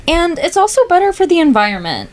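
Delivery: ordinary talk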